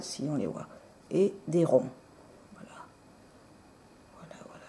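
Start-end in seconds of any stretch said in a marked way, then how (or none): 2.5-4.6 breathy whispered voilà. Real, not acted. Vlogger whispering to viewers while showing polish. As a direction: murmur it under your breath.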